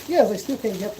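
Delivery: ordinary talk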